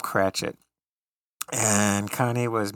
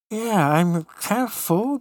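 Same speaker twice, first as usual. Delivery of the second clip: mock full; 11 semitones higher, 4 dB louder, much slower than usual. Joking about dessert.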